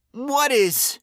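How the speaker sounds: high, loud frightened cry; terrified man shouting into the dark; bawling in fear. A narrator's reading. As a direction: wail the question. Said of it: "Lenny bawled".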